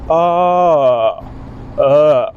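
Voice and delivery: "Uh" dropping into a creak; gargly throat uh. Shown as a demonstration. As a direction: go from normal down into a gargle. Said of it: "gargly throat sound".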